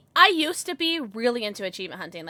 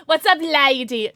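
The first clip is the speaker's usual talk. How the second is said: cheery loud greeting; 3 semitones higher, 9 dB louder, slower than usual. Real, not acted. Greets game character loudly.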